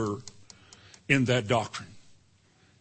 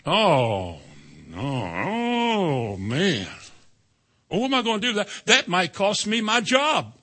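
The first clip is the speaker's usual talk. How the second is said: worried; 3 semitones higher, slower than usual. Retold or imitated. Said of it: "voicing worried believer".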